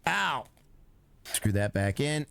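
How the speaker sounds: ordinary talk, nothing marked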